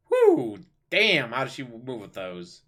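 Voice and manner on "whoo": high loud whoop of delight; excited whoop. Real, not acted. Reacting to elf avatar.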